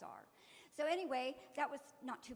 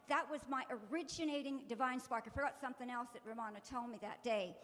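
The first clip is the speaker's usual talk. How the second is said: ordinary talk, nothing marked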